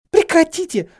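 high, loud cry; desperate scream. A narrator's reading. Hermione wedging between the two, screaming. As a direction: scream at them to break it up. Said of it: "закричала она".

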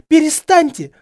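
high-pitched, very loud plea; desperate scream. A narrator's reading. Hermione between Harry and Snape, crying out. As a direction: cry out to stop a fight. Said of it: "закричала она".

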